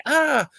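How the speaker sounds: high, short yelp of pain; a quick high pained yelp; mock cry of pain. Girl yelps as her skin peels in a high mock cry.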